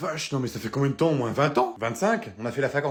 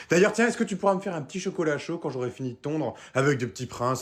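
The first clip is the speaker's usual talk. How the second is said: ordinary delivery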